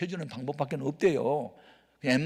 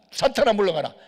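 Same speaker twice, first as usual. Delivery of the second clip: loud, higher command; a preacher's loud rebuke of temptation; rebuking Satan; 8 semitones higher, 10 dB louder than usual. Retold or imitated. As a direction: cast it out with force.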